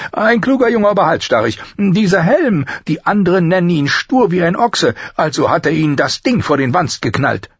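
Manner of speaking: very high, loud craftsman voice; animated master smith complaining of a stubborn pupil; master armourer, exclaiming. Acted. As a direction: talk up your apprentice in a high, forceful voice.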